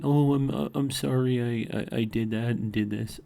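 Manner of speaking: mock whiny apology. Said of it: "come crying to me and, you know, saying".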